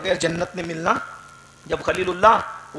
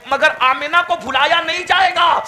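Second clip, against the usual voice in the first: proud cry; 9 semitones higher, 7 dB louder than usual. Retold or imitated. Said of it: "لیکن کہتی ہے".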